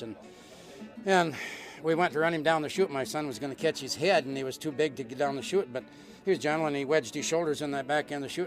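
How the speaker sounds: ordinary delivery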